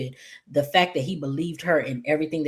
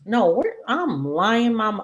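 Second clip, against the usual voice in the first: usual speaking voice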